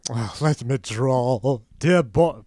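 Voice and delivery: slow, hammy theatrical drawl; hammy theatrical actor. Retold or imitated. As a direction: ham it up like a stage actor. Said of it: "What a ham".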